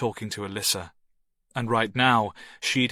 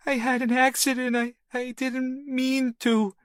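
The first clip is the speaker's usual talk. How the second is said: put-on weeping little boy. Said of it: "little boy weep".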